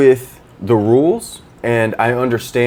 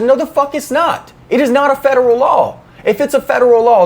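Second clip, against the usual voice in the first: much higher, a touch louder, mock furious retort; retold angry yelling; 13 semitones higher, 3 dB louder than usual. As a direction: replay the fight, voice pitched way up in outrage. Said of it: "I was screaming".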